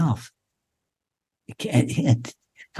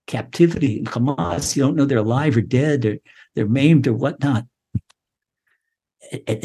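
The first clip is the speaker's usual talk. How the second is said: ordinary talk, nothing marked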